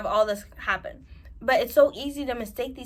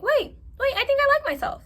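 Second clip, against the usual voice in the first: sudden realization; 6 dB louder than usual. Retold or imitated. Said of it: "once you start being like".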